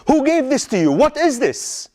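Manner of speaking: sharp, high questioning; alarmed father interrogating; angry demand. Acted. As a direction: confront him, demanding answers. Father confronts son over poison.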